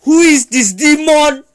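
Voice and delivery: hammed-up high, slow, loud denunciation; stunned shouting. Stunned Kushina sees Naruto's changed eyes, hammed-up alarmed shout. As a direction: cry out as if facing a demon. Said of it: "Kushina is stunned".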